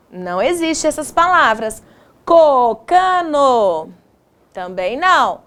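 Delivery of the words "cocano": "Cocano" very slow, each syllable stretched; sounding out. Shown as a demonstration. Teacher testing made-up word.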